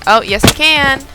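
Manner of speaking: ordinary talk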